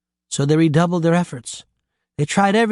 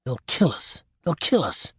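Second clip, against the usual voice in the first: muttered in dread; 5 dB quieter than usual. A narrator's reading. Jack fearing the cannibals, a soft dread mutter. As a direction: mutter the doom to himself, barely audible. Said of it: "Jack muttered".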